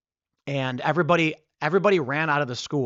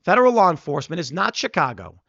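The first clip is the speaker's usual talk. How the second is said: ordinary talk, nothing marked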